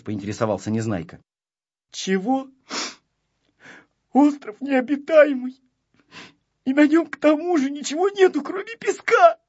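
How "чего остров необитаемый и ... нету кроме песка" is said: high, tearful wail, building louder; acted crying, pitch climbing to the end. A narrator's reading. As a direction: wail out the complaint like a child. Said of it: "и заплакал".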